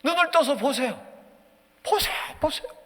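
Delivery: high, loud cry to God; acted desperate plea; pleading prayer. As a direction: beg urgently to be seen. Acts king's plea to God.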